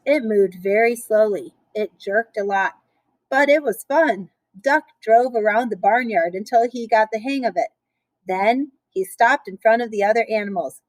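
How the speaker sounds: ordinary delivery, nothing marked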